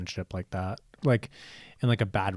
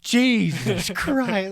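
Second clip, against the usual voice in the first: high, drawn-out, awed "Jesus Christ"; stunned gasp; 10 semitones higher, 6 dB louder, much slower than usual. Retold or imitated. Friends stunned into silence by his threat, voiced high and slow. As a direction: stretch it out slowly, higher and louder, mock-shocked.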